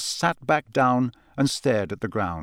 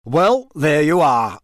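louder, drawn-out showman; slow, hearty wrap-up of proceedings; jovial host; 3 semitones higher, 5 dB louder, slower than usual. A narrator's reading. Bagman to the champions after the draw, hearty and slow.

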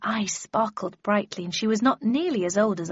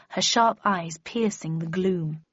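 ordinary delivery

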